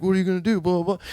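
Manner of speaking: high mock-tough kid; goading before the punch; bully challenge.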